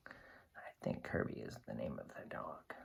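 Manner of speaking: unvoiced whispered aside. Real, not acted. Whispering a hint to the children, hushed. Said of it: "aside to listener".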